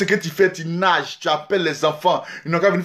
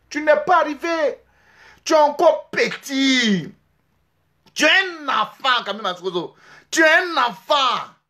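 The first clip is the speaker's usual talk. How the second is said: scolding shout; 6 semitones higher than usual. Real, not acted. Scolding rival on a livestream.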